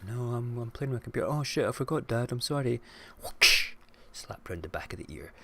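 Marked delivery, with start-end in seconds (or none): 0.1-2.8 imitating a boy, a little higher; a hint of a kid's excuse, pitched up; lightly voiced son. Oldest boy apologising to dad for playing computer, lightly voiced son. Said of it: "oldest boy decided". 3.2-3.7 loud breathy imitated whoosh; voiceless swish of a mock slap; whoosh noise. Dad mimicking a slap round the ear, whoosh noise.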